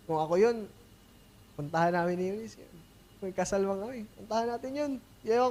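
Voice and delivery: ordinary talk, nothing marked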